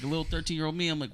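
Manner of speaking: ordinary talk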